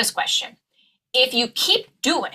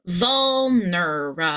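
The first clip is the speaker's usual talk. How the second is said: "vulnerable" slowed into vul-ner-uh-bull; syllable by syllable; 3 semitones lower, slower than usual. Shown as a demonstration. Coach breaks down the word for learners, slow and syllable by syllable. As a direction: stretch each syllable of "vulnerable". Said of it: "the final syllable is a consonant cluster".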